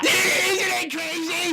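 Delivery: sudden high-pitched yell. Real, not acted. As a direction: yell it out in excitement. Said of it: "lower the hell out of that yell".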